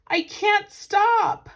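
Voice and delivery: slow, high, loud panicked yell; frantic shout. Acted. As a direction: stretch out the cry as he careens. Man on runaway bicycle.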